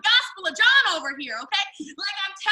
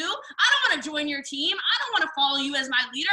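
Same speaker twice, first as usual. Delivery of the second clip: usual speaking voice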